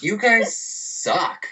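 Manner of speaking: put-on high, drawn-out drunken jeer; mock drunk taunt; wasted heckler. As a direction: imitate a drunk guy heckling, slowly. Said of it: "opens the door right wasted".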